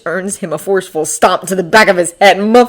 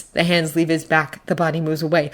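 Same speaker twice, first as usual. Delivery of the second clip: usual speaking voice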